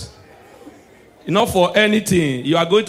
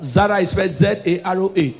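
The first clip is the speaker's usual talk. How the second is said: usual speaking voice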